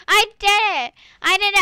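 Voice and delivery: loud, high victory cry; triumphant shout. Real, not acted. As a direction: shout it like you just won. Triumph after a hard jump.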